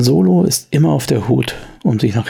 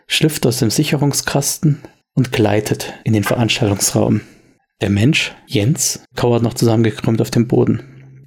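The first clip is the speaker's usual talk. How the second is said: ordinary talk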